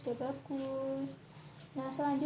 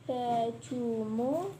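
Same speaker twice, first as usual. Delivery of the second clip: very slow, clear model reading; over enunciating; much slower than usual. Shown as a demonstration. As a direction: say it slowly so she can copy. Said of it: "Coba diucapkan lagi".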